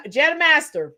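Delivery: normal talking voice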